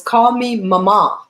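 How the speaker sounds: "mama" with the stress on the last syllable, ma-MA; slowly, landing on the second syllable of "mama"; elderly lady's "mama". Retold or imitated. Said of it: "She said it that way".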